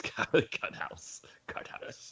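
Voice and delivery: breathy whispered words; whispering. Acted. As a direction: whisper it under your breath. Whispered repeat.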